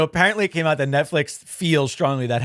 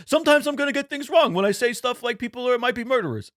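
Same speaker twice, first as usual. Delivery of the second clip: put-on a light, higher New York accent; 9 semitones higher than usual. Podcast host jokingly slipping into a New York accent. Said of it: "like, a New York".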